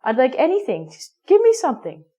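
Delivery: a customer who just wants anything, getting pushier; careless customer. Acted. Teacher playing a customer who wants any muffin, rising impatiently.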